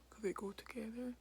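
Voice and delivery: ordinary talk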